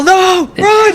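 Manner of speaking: high shrieking run warning; mock panic shout. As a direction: yell it in mock terror. GM mock panic over cat.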